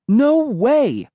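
high, emphatic refusal; crying out. A narrator's reading. Narrator refusing sister's demand, high indignant cry. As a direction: cry out in high-pitched protest. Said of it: "I cried".

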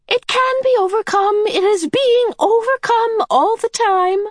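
high, delighted cry; happy medium crying out. A narrator's reading. The Medium cheering the children, high and happy. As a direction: beam as you cry it out. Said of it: "the medium cried, smiling happily".

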